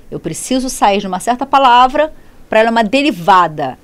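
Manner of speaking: ordinary talk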